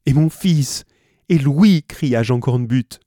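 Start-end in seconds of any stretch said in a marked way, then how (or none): 0.1-1.8 loud, higher anguished cry; despairing cry. A narrator's reading. Father Cornbutte crying for his lost son, loud despair. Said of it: "avec l'accent du plus profond désespoir".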